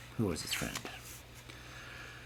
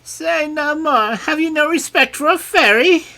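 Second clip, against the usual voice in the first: angry fairy; 9 dB louder than usual. A narrator's reading. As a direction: scold the king sharply. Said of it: "answered she angrily".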